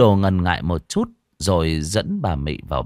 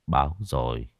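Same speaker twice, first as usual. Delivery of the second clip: lower, softer, weary answer; heavy sigh; 5 semitones lower, 5 dB quieter than usual. Acted. Old teacher answers Mị on a weary sigh. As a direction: let it out on a long tired sigh. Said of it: "Ông Đồ thở dài sườn sượt".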